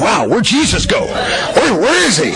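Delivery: high-pitched, startled searching; hammed-up confused onlooker, voice jumping up; mock bewilderment. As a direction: play a baffled onlooker looking all around. Acting out baffled onlookers looking around.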